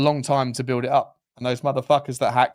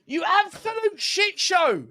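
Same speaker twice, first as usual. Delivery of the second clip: high, slow, furious insult; slower than usual. Real, not acted. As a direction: spit it at the ref. Insulting the ref.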